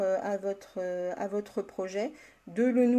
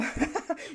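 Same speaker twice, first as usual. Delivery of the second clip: short higher giggle; caught-out giggle; embarrassed laugh; 5 semitones higher, 4 dB quieter than usual. Real, not acted. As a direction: burst into a quick real laugh. Teased about her English.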